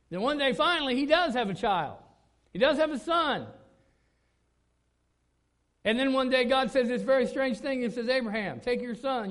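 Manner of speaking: loud, high, fervent sermon delivery; preaching at full force. Acted. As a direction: preach it to the back row. Preacher builds to climax.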